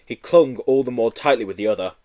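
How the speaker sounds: ordinary delivery, nothing marked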